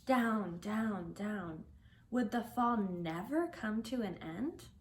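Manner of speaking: ordinary delivery, nothing marked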